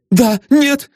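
put-on high, startled girl's answer; high startled denial; frightened young lady. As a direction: blurt it out like a scared young lady. Frightened girl answers detective.

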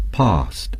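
British English past, with a long open ah; British pronunciation of past. Shown as a demonstration. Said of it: "The British would say".

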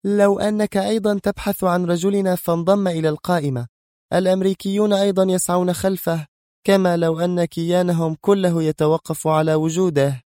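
high-pitched mocking woman's voice; sarcastic. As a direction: taunt him in a high, sardonic voice. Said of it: "قائلة بلهجتها الساخرة". (بلهجة ساخرة)

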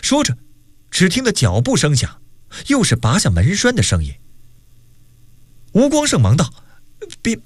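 animated storyteller with acted dialogue; story narration. A narrator's reading. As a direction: narrate the tale with wide swings in pitch. Narrates a novel chapter. (绘声绘色地讲小说)

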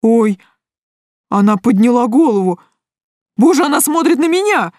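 high, alarmed character voice; frightened guy realising he has been seen; spooked. A narrator's reading. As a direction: build from startled gasp to panicky cry. Guy sees the witch look up.

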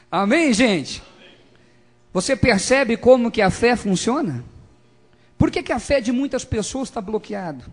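loud, high rhetorical questions; preacher ringing out questions; full-force preaching. Real, not acted.

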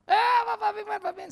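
high, loud greeting across the room; loud cheery call to a rival. Retold or imitated. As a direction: hail him across the restaurant. Calling out to old enemy.

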